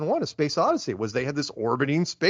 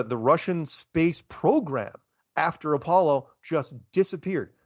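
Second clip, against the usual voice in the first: ordinary talk, nothing marked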